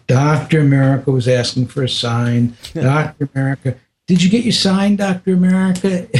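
high, gleeful mocking taunt; teasing. Guru slapping and teasing the crying disciple, gleeful. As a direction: rub it in with delight. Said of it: "playing with my beard and slapping me".

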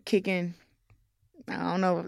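usual speaking voice

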